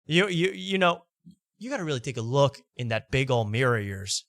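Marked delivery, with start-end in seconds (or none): none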